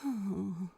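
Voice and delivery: a quiet, tired sigh out; soft sigh. A narrator's reading. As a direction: let a soft sigh escape. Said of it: "couldn't stop the sigh from escaping him".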